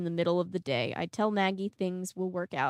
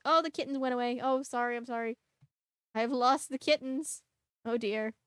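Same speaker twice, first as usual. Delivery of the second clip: higher-pitched little pretend fretting; mock dismay; 6 semitones higher than usual. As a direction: fret in a high mock-apologetic voice. Mock fretting over the kittens.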